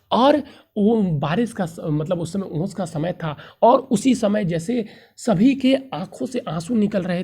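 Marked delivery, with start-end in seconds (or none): none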